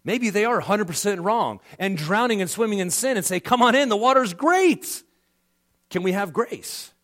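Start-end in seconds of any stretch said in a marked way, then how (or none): 3.4-5.0 tempting call. Retold or imitated. Sinner inviting the believer in.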